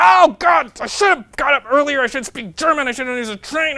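high, loud self-scolding; mad at yourself. Retold or imitated. A traveller scolding himself for his mistakes, loud and high. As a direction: beat yourself up in a hammed-up wail. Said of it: "you start getting super mad at yourself".

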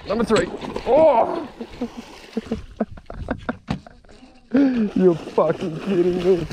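high-pitched, thrilled exclaiming; real excitement. Real, not acted. Angler hooking barra number three, excited and high. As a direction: celebrate in disbelief, voice shooting up. Hooks a third barra, celebrating.

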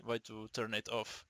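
ordinary talk, nothing marked

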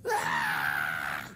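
low, drawn-out raging yell; imitated rage scream. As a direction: let out a long mock roar of rage. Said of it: "like, rage and screaming".

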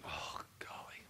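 hushed "oh, golly" to himself; whispered dismay. Retold or imitated. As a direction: whisper it as a sinking thought. Retelling his sinking inner thought.

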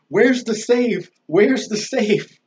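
slow, high mock robber demand. Retelling the robber asking him for the safe, slow mock demand.

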